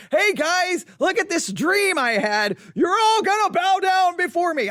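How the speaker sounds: high-pitched, gleeful bragging; excited Joseph. Acted. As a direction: crow it high, hammed-up and boastful. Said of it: "he seems pretty excited to go tell everyone".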